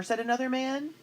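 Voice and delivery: ordinary delivery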